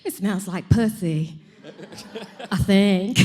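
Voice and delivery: slow, loud, arch campy comic voice with a laugh; comedian's dirty joke in a campy Paul Lynde voice; Paul Lynde impression. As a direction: camp it up, slow and knowing. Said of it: "my favorite Paul".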